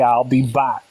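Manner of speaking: lightly put-on action-hero catchphrase; a hint of an action-star impression; Arnold Schwarzenegger. Joking to the panel about the universe's edge, lightly imitated Arnold Schwarzenegger. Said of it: "I was thinking Arnold Schwarzenegger".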